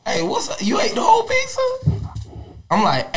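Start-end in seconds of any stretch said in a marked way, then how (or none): 0.1-1.6 hushed start, then a squeaky high question; mock the roommate with a high squeal; mocking quote. Retelling roommate's pizza question in a squeaky mocking voice.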